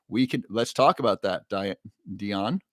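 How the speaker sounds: usual speaking voice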